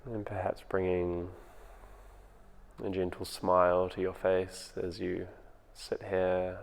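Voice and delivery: calm, unhurried meditation voice with long pauses; guided meditation. Acted. Teacher guiding home listeners through their breathing, in a calm meditation voice. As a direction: ease them into the breath, gently.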